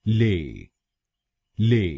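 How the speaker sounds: ले alone, "lay" with silent T; isolated, drawn-out ले for learners; syllable demo. Shown as a demonstration. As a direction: model ले as a separate syllable. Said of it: "हम बोलेंगे".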